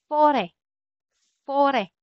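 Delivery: French "fore" with a longer open final vowel; French pronunciation. Shown as a demonstration. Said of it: "softer or longer kapag binibigkas".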